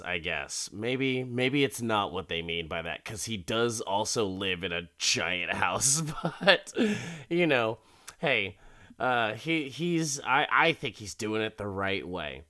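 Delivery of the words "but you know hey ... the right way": normal talking voice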